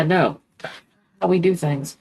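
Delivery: normal talking voice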